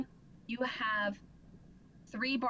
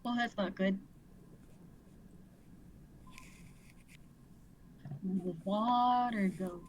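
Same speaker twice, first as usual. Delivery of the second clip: bugbear voice; 2 semitones lower than usual. Acted. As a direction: drag out the bugbear's words. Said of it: "This is my bugbear voice".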